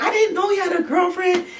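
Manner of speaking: imitated high-pitched wail of the hidden girl; other girl screaming. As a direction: play the caught girl crying out. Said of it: "The girl screaming".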